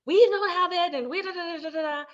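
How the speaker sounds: hammed-up scolding rant; mock nagging. Wife scolding husband over spending, hammed-up nagging. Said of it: "I'm like".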